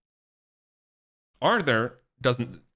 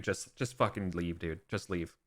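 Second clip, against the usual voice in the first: much softer, a tired king sending him away; quiet weary dismissal; 3 semitones lower, 9 dB quieter than usual. Retold or imitated. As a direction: let it drop, exhausted, no fight left.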